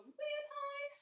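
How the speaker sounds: way up high, soft operatic pitch; opera singer high. Shown as a demonstration. Teacher showing kids a silly om, opera singer high. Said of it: "do our opera singer om".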